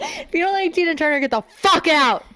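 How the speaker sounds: high, fast, loud laughing yell; laughing shout. Real, not acted. Laughing mock threat to listeners.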